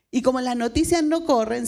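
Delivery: ordinary talk, nothing marked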